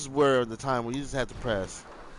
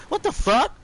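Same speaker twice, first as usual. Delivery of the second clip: much higher, louder stunned cry; outraged disbelief; 7 dB louder than usual. Retold or imitated. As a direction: blurt it out in shock. Retold outraged reaction.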